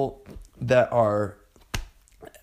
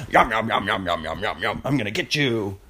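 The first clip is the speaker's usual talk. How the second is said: low, hungry demon muttering yum; 4 semitones lower than usual. Retold or imitated. A demon outside the box gloats over its prey, low and hungry. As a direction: play a greedy demon licking its lips. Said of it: "the demon's gonna be like".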